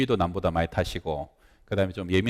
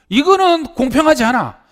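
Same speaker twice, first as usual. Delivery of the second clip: high, loud outraged protest; young pastor seething over the insult; fuming indignation; 9 dB louder than usual. Retold or imitated. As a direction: boil over at the unfairness. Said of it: "울그락불그락해서".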